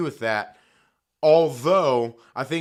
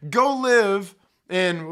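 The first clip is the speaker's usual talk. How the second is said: "go live in" dragged out slowly, louder; slow emphatic repeat; 3 semitones higher, 4 dB louder, much slower than usual. Real, not acted. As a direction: stretch each word of "go live in". Same rant, drawn out.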